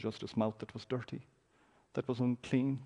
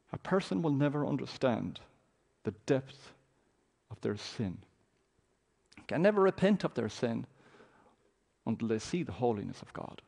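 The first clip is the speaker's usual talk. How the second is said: ordinary talk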